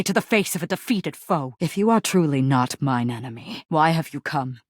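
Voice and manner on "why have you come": usual speaking voice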